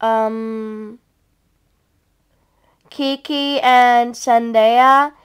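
slow, drawn-out recital of names; hesitant. Pretend. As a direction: draw each name out, unsure. First take, recalling the names.

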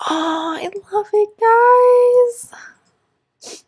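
high, slow squeal of delight. Real, not acted. Vlogger gushing over photos, high slow squeal. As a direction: stretch out the love.

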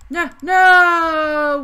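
sudden loud high cry of no; shouting no. Real, not acted. Gamer yelling at the screen, loud no. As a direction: yell it out as the game goes wrong. Game going wrong, shouts.

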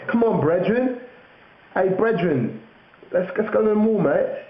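high-pitched mock London lad; London slang. Urging a friend to the mall, mock London accent. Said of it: "And then they're like".

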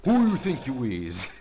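high, loud mock-gangster taunt; mock tough taunt. Gamer taunting a bot he just killed, loud and mocking.

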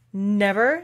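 loud furious denial, mildly acted; one forceful, indignant burst of volume; storybook queen's angry outburst. A narrator's reading. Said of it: "furiously throwing an inkstand at the lizard".

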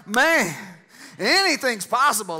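high, loud burst of wonder; excited believer. Acted. Preacher voicing God's kids to the church, excited. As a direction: exclaim it like an amazed kid. Voices kids' wonder at possibilities.

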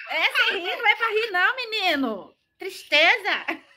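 high-pitched, giggly telling-off; real laughing scolding. Real, not acted. Mother laughing while telling child not laugh. (bronca rindo, voz aguda)

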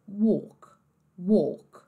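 "walk" with a rounded closed oh, silent L; British closed vowel. Shown as a demonstration. Teacher showing the British word, closed-O "walk". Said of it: "no inglês britânico".

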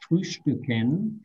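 frühstücken said slowly, each syllable spelled out; teacher slowing down so students can copy the word; slow demo word. Shown as a demonstration. Teacher to students learning German, slow careful demo. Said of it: "tal vez va a ser un poco difícil la pronunciación".

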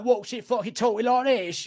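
put-on high-pitched caricature of a rough British accent; mock British accent. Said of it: "with a Brummie or with a Cockney".

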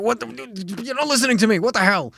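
high, loud exasperated outburst; angry phone rant. Acted. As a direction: yell at someone who won't listen. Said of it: "when you're angry on the phone".

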